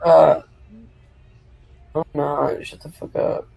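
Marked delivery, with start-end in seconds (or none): 2.7-3.5 whispered insult. Real, not acted. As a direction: hiss it under your breath. Unvoiced hissed insult.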